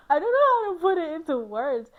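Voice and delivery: gush, lost for words; flustered excitement. Real, not acted. Describing how she wants to be loved, flustered.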